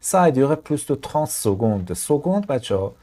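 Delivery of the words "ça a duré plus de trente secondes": "plus" said with its final s sounded, slowly; pronounce the s. Shown as a demonstration. Teacher showing learners the s in plus, slow and clear.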